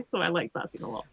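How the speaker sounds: usual speaking voice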